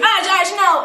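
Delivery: high, urgent imitation of calling a name; mock panicked plea. Fez begging Ash to stop, high mock panic. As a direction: pitch it up, pleading him to stop. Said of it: "Because you".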